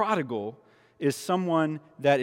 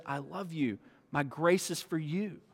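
much softer, tender; tender father; 9 dB quieter than usual. Retold or imitated. Father to sons, soft tender reassurance. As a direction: say it softly to a child. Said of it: "he says".

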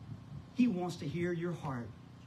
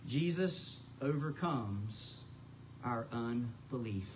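slow emphasis; 4 semitones lower, 4 dB quieter, slower than usual. Shown as a demonstration. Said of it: "repeated for emphasis".

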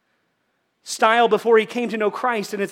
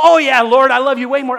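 loud breezy answer; 3 semitones higher, 12 dB louder than usual. Pretend. Peter's easy flippant answer.

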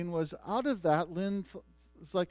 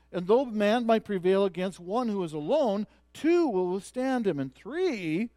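loud, raised-pitch preaching; forceful preaching; 6 semitones higher, 9 dB louder than usual. Real, not acted. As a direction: drive each verse home at full pulpit force. Preaches through the verses.

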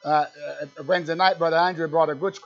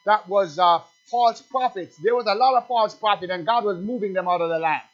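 raised, higher preaching voice, driving hard; fiery preaching; 4 semitones higher, 6 dB louder than usual. Acted. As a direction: preach it out to the congregation at full force. Preacher at full pitch.

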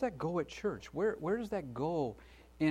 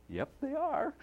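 squeaky pleased reply; 14 semitones higher, 3 dB quieter than usual. Retold or imitated. Dad answers the manager.

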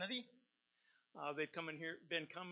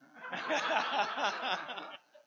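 bright burst of laughter; hearty high laugh; 9 semitones higher, 8 dB louder than usual. Real, not acted. Laugh after draw remark.

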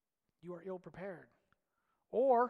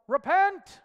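much louder and higher, a sudden shouted order; sailor's yell; 9 semitones higher, 16 dB louder than usual. Retold or imitated. Sailor yelling to crew to turn ship, a loud shout. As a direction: bellow it across the deck. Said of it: "Somebody would just yell".

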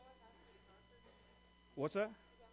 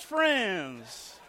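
ordinary delivery

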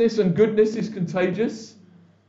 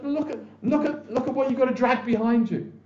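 taunting enemy; 6 semitones higher, 3 dB quieter than usual. Retold or imitated. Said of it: "He goes".

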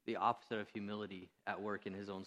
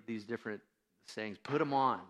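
ordinary delivery

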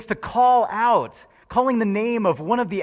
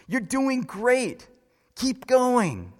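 slow, raised, drawn-out encouragement; encouraging cheer; 7 semitones higher, much slower than usual. Retold or imitated. Father cheering his last-place son on, slow proud encouragement. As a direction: urge him on, slow and proud. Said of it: "continued to cheer for his boy".

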